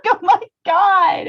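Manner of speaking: high, loud, drawn-out mock panic; retold fright. Retelling being startled by the intruder alert, mock fright. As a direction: cry out in exaggerated alarm, slowly. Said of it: "It was so scary".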